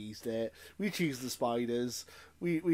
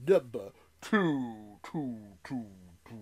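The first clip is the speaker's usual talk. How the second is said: slow announcement fading away like an echo; mock echo; 3 semitones lower, slower than usual. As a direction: repeat the last word as a dying echo. Mock echo announcing the next pick.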